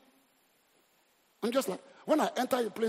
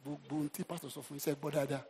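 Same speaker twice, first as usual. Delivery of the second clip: softer, quick mock wife voice in Yoruba; wife quoting pastor; 7 semitones lower, 10 dB quieter, faster than usual. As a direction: lightly imitate a wife lecturing her husband. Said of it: "She'll go back home and say".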